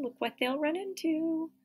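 ordinary delivery

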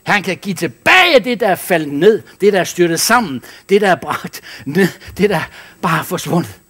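high, loud preaching cry. Acted. Pastor exhorting the congregation, loud high preaching.

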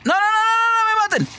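high, panicked plea; panicked pleading. Real, not acted. Player begs enemies not to kill him, panicked pleading.

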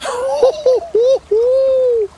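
giddy, high-pitched burst of laughter; high delighted laugh. Real, not acted. Landing biggest trout of life.

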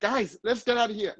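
quick American movie delivery; acted movie line. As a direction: play the movie hero calling friends out. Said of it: "ด้วย acting แบบ".

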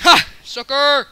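loud gloating shout, high; taunting yell. Real, not acted. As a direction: yell the taunt at the beaten player. Taunting after a kill.

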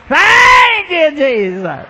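high, loud, drawn-out wail; same crying monotone. Retold or imitated. Lady changing her words, still mock wailing. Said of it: "Same old monotone".

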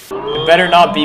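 ordinary talk, nothing marked

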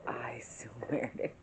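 slow whispered oath; quiet whispered disbelief; hushed whisper. Retold or imitated. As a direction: whisper it, half shocked. Retold reply to prank.

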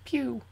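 high-pitched "pew" zap; laser gun noise. Pretend. Player mimicking generic laser gun sounds, playful. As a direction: imitate a toy laser shot. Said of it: "Generic laser gun sounds".

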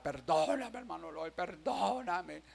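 soft, drawn-out mock plea; llanto imitado. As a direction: imitate a tearful plea for forgiveness. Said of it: "se me tiró en los brazos a llorar". (súplica llorosa imitada, en voz baja)

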